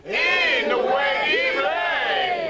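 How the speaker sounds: high, loud cry with hugely stretched vowels; rallying cry. Pretend. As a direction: shout it out long and high like a crowd chant. Catchphrase shouted after "we cry.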